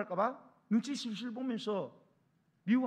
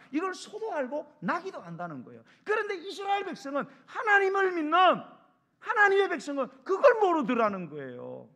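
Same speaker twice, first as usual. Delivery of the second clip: loud, raised pulpit voice; preaching at full force; 7 semitones higher, 8 dB louder than usual. Real, not acted. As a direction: drive the point home, high and forceful. (힘주어 외치는 설교)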